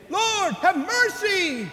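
high, slow, drawn-out plea for mercy; crying out. Acted. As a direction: cry it out like a desperate leper begging. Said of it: "They cried out".

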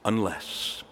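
hushed, weighty Unless; quiet emphasis. Shown as a demonstration. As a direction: lean in and say Unless low and soft.

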